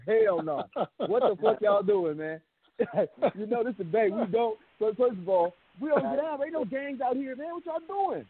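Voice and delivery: high-pitched, animated disbelief; incredulous protest. Retold or imitated. Teller replaying his telling-off of the crew, incredulous. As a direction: act out challenging your friends, voice climbing as you go.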